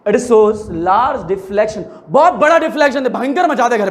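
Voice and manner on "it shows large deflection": very slow dictation; dictating. Shown as a demonstration. Teacher dictating slowly for students to write. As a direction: stretch each word.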